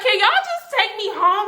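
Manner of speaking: high, whiny plea; begging to be taken home; pleading whine. Retold or imitated. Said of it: "I was just like".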